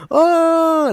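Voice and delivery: a high, hammed-up pained "oh"; mock whimper. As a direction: imitate the fallen player crying out. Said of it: "He's like".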